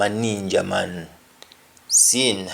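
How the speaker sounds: ordinary talk, nothing marked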